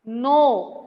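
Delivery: "नो" said alone, starting straight on n; silent k. Shown as a demonstration. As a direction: say "नो" with no k sound. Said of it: "के silent होता".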